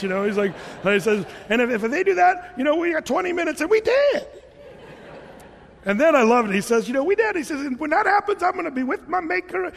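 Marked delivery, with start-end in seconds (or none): 1.5-4.3 imitating the driver. Pastor voicing driver on video. 6.9-7.4 high, laughing imitation of a carefree driver; high, amused voice of a man at peace; mimicking the driver. Pastor imitating a YouTube driver telling his wife. 7.6-9.7 high, laughing imitation of a carefree driver; high, amused voice of a man at peace; mimicking the driver. Pastor imitating a YouTube driver telling his wife.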